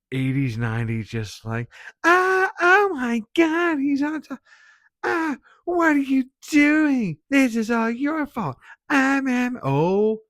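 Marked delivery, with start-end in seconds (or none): 2.0-10.2 mock shriek, very high and very loud; obnoxious high-pitched screaming. Reviewer imitates the redheaded girl in the movie, obnoxious high-pitched screaming. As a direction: screech it like an eighties horror scream queen. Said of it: "that high pitch, obnoxious".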